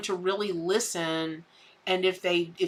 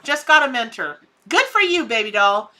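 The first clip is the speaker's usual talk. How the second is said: cheering; 3 semitones higher, 7 dB louder than usual. Real, not acted. Cheering the viewer on.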